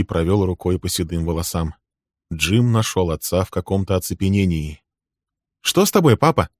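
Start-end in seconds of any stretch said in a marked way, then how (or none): 5.6-6.5 a hint of a boy's voice, higher; lightly voiced son. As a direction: son worried about his dazed father, higher voice. Jim voiced by narrator.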